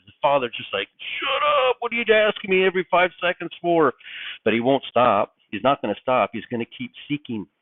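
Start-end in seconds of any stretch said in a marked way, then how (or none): 1.2-3.9 high-pitched outburst from a worn-out dad; acted snap of lost patience; exasperated father. Father worn out by questions every five seconds, exasperated. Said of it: "you're just wearing out the father".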